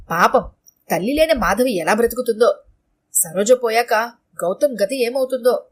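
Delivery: plain reading voice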